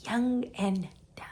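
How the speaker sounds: rueful whisper. Real, not acted. Looking back on her naivety, whispered. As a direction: breathe it out to yourself.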